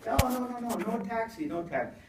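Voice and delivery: high, loud, insistent mock hotel clerk. Hotel clerk refusing the guest a taxi, high insistent mock voice.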